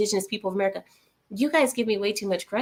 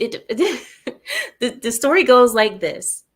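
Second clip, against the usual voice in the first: laughing; 2 semitones higher, 5 dB louder than usual. Real, not acted. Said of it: "I can't even say this without laughing".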